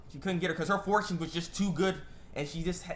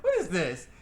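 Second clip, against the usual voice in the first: laughing; 6 semitones higher than usual. Retold or imitated. Baffled reaction while laughing.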